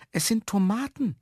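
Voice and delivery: high-pitched little protest; pleading Herr Jaromir. A narrator's reading. Man defends his plants to caretaker, high plea.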